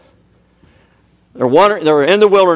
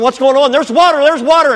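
higher, louder eager shouting; excited crowd cries; 12 semitones higher, 6 dB louder than usual. Acted. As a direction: shout the news down the line. Crowd voices in story.

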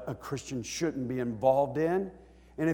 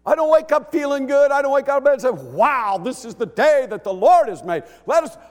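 full-force preaching; 10 semitones higher, 9 dB louder than usual. Real, not acted. Preacher urging the congregation to praise, full force. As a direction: lift the church with a booming sermon.

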